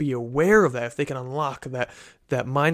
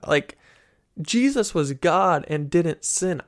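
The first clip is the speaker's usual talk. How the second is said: ordinary talk, nothing marked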